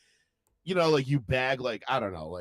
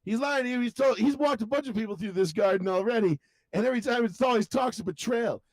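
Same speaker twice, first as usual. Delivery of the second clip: high-pitched cartoon ranting; mock-urgent warning; 8 semitones higher than usual. Old man exposing Cartman to his friend, mock urgency. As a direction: rattle out the accusation in a raised voice. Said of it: "And he's like".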